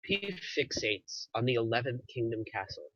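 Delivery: usual speaking voice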